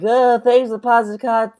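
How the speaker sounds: mock a clueless streamer thanking a tipper; [name]. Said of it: "And you're like".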